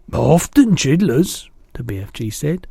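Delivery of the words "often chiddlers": louder, slower friendly giant voice; a big kindly giant chatting; booming gentle giant; the BFG. A narrator's reading.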